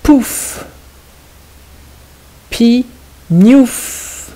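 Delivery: "Pouf" and "Pignouf" high, with long "ouf" endings; syllable by syllable. Shown as a demonstration. Teacher dictating the last puzzle, words stretched syllable by syllable. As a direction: split "Pignouf" in two and draw out each beat. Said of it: "il faut bien écouter la deuxième syllabe".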